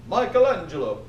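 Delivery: hammed-up Italian pope calling out; mock Italian accent. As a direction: call out in a put-on Italian accent. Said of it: "It's my Italian accent".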